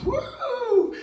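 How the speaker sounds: high, loud "woo!"; joyful, high-pitched cheer; gleeful whoop. Retold or imitated. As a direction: whoop like an excited kid. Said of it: "I felt like a kid".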